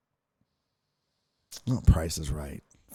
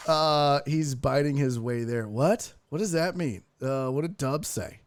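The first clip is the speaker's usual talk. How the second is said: normal talking voice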